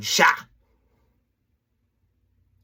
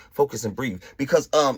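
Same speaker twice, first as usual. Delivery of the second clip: ordinary talk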